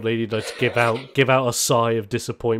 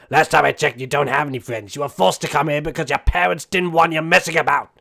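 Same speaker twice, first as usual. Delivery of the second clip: louder, lightly voiced scolding old lady; scolding grandma; 4 semitones higher, 5 dB louder than usual. Grandma scolding Kickflip about his friends, lightly mocking. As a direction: tell off the grandson.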